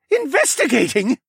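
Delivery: high, loud incredulous question; indignant, rising outburst; spat out. A narrator's reading. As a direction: spit the word back in disbelief. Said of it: "the ox demi-human spat out".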